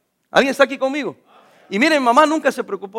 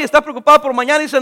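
usual speaking voice

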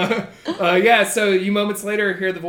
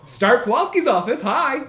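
bright phone greeting, higher and louder; chipper receptionist voice; 4 semitones higher, 5 dB louder than usual. Acted. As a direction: answer the phone like an eager assistant. GM voices Starp answering phone.

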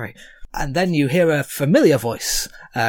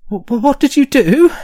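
high, loud, panicked stutter; terrified stammer; 10 semitones higher, 7 dB louder than usual. Acted. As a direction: stammer in fright at the shaking ground. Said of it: "Randall looks around terrified".

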